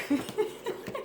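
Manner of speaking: airy unvoiced laugh; a soft breathy giggle; quiet airy laugh. Real, not acted. Laughs after goofing.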